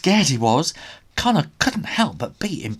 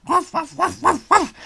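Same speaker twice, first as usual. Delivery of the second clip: much higher, rapid dog barks; wild yappy barking; 5 dB louder than usual. A narrator's reading. Trixie barking wildly at the ogre, yappy. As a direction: bark like a frantic little dog. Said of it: "Trixie barked wildly".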